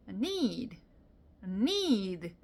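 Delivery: NEED slowly stretched on the vowel, falling; stressed, lengthened "need"; drawn-out need. Shown as a demonstration. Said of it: "이렇게 발음을 합니다".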